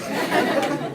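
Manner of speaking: loud breathy laugh; breathy burst of laughter. Real, not acted.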